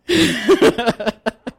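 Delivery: loud high laugh at herself. Real, not acted. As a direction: burst out laughing at the joke. Laughing at own panic bit.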